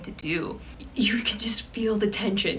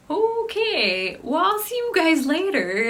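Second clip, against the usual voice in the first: mock casual goodbye; 9 semitones higher, 6 dB louder than usual. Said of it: "and is like".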